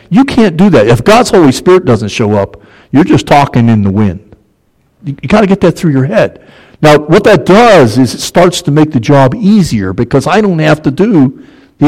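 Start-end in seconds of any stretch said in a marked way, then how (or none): none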